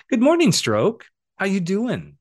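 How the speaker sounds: high, loud sing-song hello; bright greeting. Pretend. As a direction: greet the stroke like an old friend. Cheerfully greeting his own stroke.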